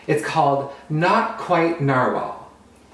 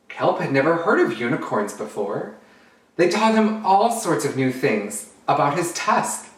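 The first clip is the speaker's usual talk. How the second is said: put-on lively storytime narration for kids; storybook reading. Drag queen reads a picture book to children, animated. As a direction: read it out like storytime for little ones.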